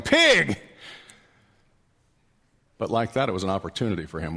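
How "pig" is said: high, loud "Pig!"; yelling. Retold or imitated. God's word yelling a warning at us, loud. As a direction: bark it out like a warning.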